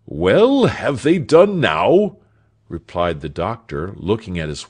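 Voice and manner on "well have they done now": high, loud retort; exasperated, loud and pitched up; impatient master. A narrator's reading. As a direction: fire the question back with raised voice. Impatient doctor answers back.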